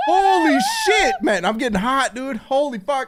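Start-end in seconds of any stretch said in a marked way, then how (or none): 0.1-1.1 very high, slow, amazed cry; real amazed yell; shocked shout. Real, not acted. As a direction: cry it out in awe. Twenty-five subs gifted.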